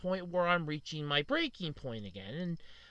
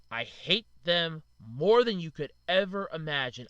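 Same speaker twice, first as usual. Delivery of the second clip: usual speaking voice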